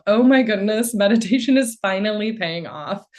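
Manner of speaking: lightly acted burst of amazement; delighted realization. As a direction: exclaim it like a happy discovery. Retells her delighted thought on noticing.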